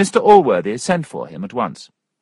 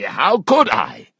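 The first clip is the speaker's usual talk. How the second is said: higher, drawn-out protest; slow, raised-pitch exclamation; indignant cry; 4 semitones higher, 5 dB louder, slower than usual. A narrator's reading. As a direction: exclaim it in helpless dismay. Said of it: "cried Mister Allworthy".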